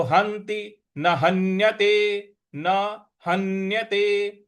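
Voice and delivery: "हन्यते" chanted with a held n; stressed half n. Shown as a demonstration. Teacher models the stress in "हन्यते", chanted. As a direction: press on the n in "हंति" and "हन्यते". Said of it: "उस पर हमको आघात देना है".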